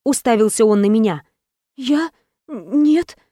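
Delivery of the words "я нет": heroine pleading. Acted.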